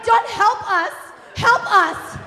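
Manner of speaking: high, loud cry for help; desperate yell. Retold or imitated. Stranded rafter to the guide, high desperate yell. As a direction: act out yelling at a useless guide.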